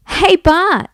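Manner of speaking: put-on high, loud girl calling over; girl in crowd. A narrator's reading. Girl in the crowd hails Bart, high-voiced. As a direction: hail him brightly as a girl. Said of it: "said a girl in the crowd".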